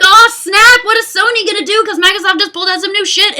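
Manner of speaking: high, loud hyped-up outburst; excited crowd reaction. Acted. Fan imagining rivals' big console reveal, excited. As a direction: blurt it like a thrilled onlooker. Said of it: "People are like".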